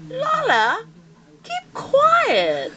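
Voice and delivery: high squeaky pet voice, put on; the pet scolding grandma in a high voice; voicing the pet. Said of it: "Sabi niya".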